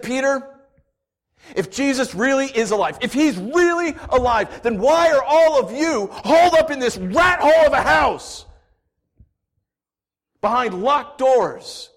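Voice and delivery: high, loud scornful shout; angry retort. Acted. Thomas shouting back at Peter, scornful and loud. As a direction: fire it back at him with contempt.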